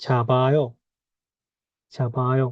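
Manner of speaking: "ছাবায়" with the b carried into the next vowel; linked pronunciation. Shown as a demonstration. Teacher showing learners where the biyop moves, linked pronunciation. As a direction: say "ছাবায়" joined up, evenly. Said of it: "বিয়োগটা এখানে চলে যাবে".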